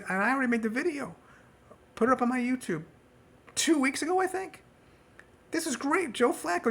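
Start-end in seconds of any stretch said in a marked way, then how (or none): 1.9-6.7 high, mocking fake enthusiasm; sarcastic. Real, not acted. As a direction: gush with obvious sarcasm. Sarcastic fake praise of Browns.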